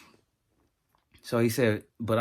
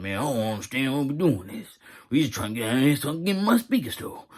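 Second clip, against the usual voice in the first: higher, louder complaining mock voice; whiny Jerome; 5 semitones higher, 6 dB louder than usual. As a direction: imitate Jerome whining about his store. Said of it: "Jerome like".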